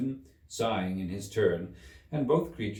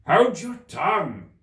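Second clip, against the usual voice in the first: high, loud, slow creature snap; snapping Gryphon; 12 semitones higher, 12 dB louder than usual. A narrator's reading. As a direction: shut her up sharply. Gryphon snaps.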